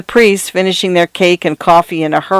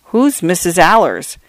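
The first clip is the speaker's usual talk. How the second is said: ordinary delivery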